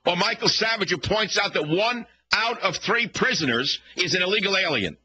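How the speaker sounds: rising, indignant rant, pitch climbing high; heated rant. Real, not acted. Radio host ranting back at his critics, heated and climbing.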